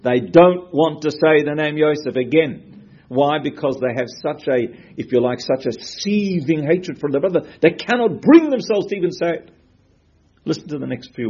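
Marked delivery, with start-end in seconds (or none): 0.0-9.5 intense emphasis. Real, not acted. Stressing the brothers' hatred.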